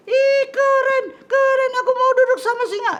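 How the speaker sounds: loud, very high squeaky puppet; thrilled high-pitched puppet; excited little puppet. Acted. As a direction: squeal with delight in a tiny voice.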